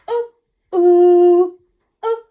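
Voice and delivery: high loud cuckoo-like hoots; cuckoo clock calls. Shown as a demonstration. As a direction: pop out each ooh like a cuckoo. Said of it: "like a cuckoo popping out of a cuckoo clock".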